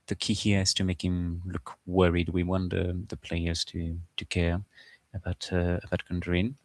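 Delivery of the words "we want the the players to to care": ordinary talk, nothing marked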